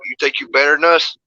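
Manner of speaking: haughty, goading VHS-shopper voice; mock snooty challenge. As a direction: lightly act out a sneering rival.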